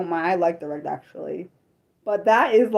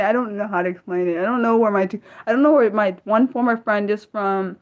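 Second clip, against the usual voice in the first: normal talking voice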